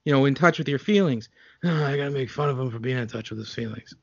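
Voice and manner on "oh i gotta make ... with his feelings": low, soft, quick mock; mocking Seagal voice. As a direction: mutter it as smug Seagal. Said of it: "you said that it was perfectly okay".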